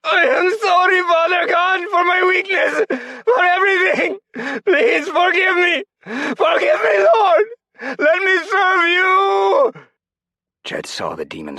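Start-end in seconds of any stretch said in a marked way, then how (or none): 0.0-9.7 much higher, much louder wailing sobs, slowing at the end; anguished crying scream. Acted. Rodrigo crying out to God for forgiveness, anguished sobbing scream. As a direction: cry it out to God in agony. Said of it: "screamed in anguish".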